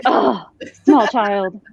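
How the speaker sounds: slow, drawn-out amused address; slow bemused. Retold or imitated. Reader greeting the small child, slow and bemused. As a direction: let it stretch, gently bemused. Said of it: "And I was like".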